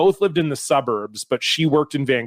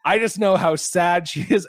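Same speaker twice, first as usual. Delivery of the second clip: a higher, pleading mom voice, half-hearted; lightly voiced worried mother, pitched up; imitating her mom; 4 semitones higher than usual. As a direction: mom defending her daughter to the ex, mock plaintive voice. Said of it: "her mom was like".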